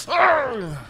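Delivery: high, loud comic "ah"; exaggerated wail; mock panicked groan. Mimics reaction to speaking English.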